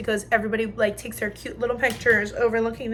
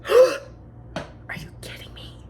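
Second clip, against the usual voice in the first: imitating a mom's gasp, then slow whispery disbelief; gasp, then draw it out in hushed mock shock; mom's shocked reaction; 11 semitones higher, much slower than usual. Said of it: "So my mom goes".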